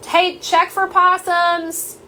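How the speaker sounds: high, slow call to the house; drawn-out shout down the hall; real yell. Real, not acted. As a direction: yell it out to someone in another room. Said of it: "I'm gonna yell for a second".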